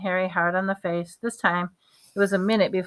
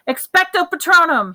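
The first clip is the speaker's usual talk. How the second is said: high, loud yelled incantation; yelled spell; 10 semitones higher, 7 dB louder than usual. A narrator's reading. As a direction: cast it with a full yell. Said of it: "Harry yelled".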